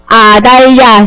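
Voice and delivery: slow, stretched syllables for repeating; drawn-out choral prompt. Shown as a demonstration. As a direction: lead the class in saying it slowly. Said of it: "시작".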